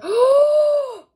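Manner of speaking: sudden high shout of surprise; a gasp bursting into a loud high oh. Real, not acted. Pack opening, sudden big pull.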